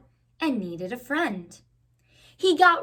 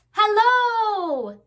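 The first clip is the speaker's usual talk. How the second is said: higher, louder call across the pond; louder calling hello; 9 semitones higher, 4 dB louder than usual. Acted. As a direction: call hello over the water. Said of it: "he called".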